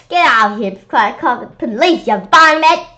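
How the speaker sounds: loud scolding; angry threat. Acted. Miss Yonkers threatening to call police, angry. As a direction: chase him out.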